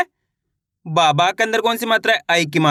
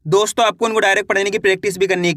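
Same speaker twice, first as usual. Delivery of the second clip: ordinary talk, nothing marked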